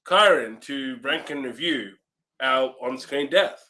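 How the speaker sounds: ordinary talk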